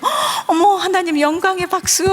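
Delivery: high thrilled squeal; gasping delight. Retold or imitated. Preacher mimics a delighted believer, gasping and squealing. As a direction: gasp with childlike joy, voice up high.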